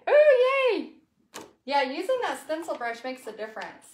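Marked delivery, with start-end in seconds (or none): 0.1-0.9 excited. Real, not acted. Stencil came out well.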